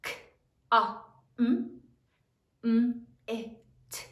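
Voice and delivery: "K, a, m, m, i, t" as isolated sounds; sounding out. Shown as a demonstration. Teacher tells class to sound it out, "K, a, m, m, i, t" sound by sound. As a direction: give each sound of "K, a, m, m, i, t" on its own. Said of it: "Sound it out".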